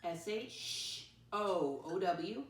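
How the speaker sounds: slow letter by letter spelling; slow, deliberate spelling. Shown as a demonstration. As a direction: spell each letter out slowly for kids. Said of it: "I can borrow it".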